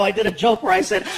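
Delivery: ordinary delivery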